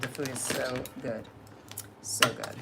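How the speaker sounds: breathy whispered So good. Shown as a demonstration. Whispering about the best gluten-free pizza. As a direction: whisper it like a secret treat. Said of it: "It's so good".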